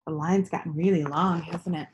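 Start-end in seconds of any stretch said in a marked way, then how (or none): none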